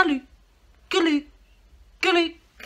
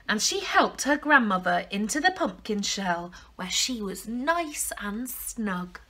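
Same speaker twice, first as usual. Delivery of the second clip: ordinary delivery, nothing marked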